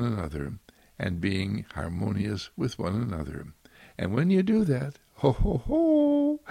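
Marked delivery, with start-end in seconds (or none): 5.1-6.4 very high, slow, loud delight; a high joyful hoot. Real, not acted. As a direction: burst out in joyful ho ho ho. Delighted exclamation.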